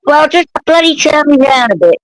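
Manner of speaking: loud, high, exasperated yell; shouted retort. Real, not acted. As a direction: shout the obvious fix at them. Shouting back about the volume.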